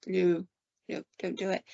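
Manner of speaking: hushed retold plea; soft aside, barely voiced; furtive warning. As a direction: drop the volume as if warning someone secretly. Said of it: "she's shaking her head like".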